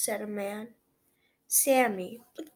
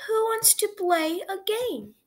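teacher voice; 9 semitones higher, 4 dB louder than usual. A narrator's reading. Teacher asks class.

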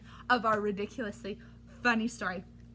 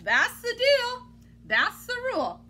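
emphatic rule; 10 semitones higher, 6 dB louder than usual. A narrator's reading. Reader telling kids the book's rule, firm and loud. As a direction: lay down the law playfully.

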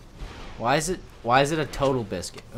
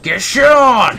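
high, loud, stretched-out yell; taunting shout; 10 semitones higher, 12 dB louder, much slower than usual. Real, not acted. Player attacking Lux, shouting a gloating taunt. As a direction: crow it at the beaten enemy.

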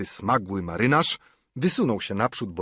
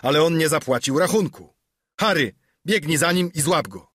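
louder, higher urgent command; ordering a chase; 6 semitones higher, 4 dB louder than usual. Acted. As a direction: send Harry after the debtor.

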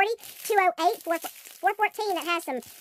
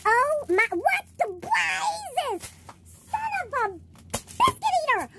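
stunned shout; 9 semitones higher, much slower than usual. Real, not acted. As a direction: yelp it out, completely floored. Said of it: "I'm so surprised I can't even talk".

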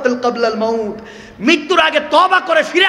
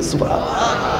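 breathy cry of wonder; awed exclamation; 4 semitones lower, 5 dB quieter than usual. Real, not acted. Preacher praising God in awe, exclaiming.

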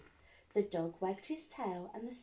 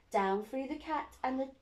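ordinary delivery

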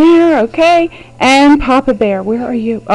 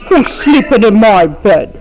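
a hint of a gruff Papa Bear, lower; lightly voiced big papa bear asking "Who's sleeping in my bed?"; 4 semitones lower than usual. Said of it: "and Papa Bear says".

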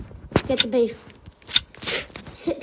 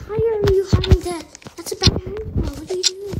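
usual speaking voice

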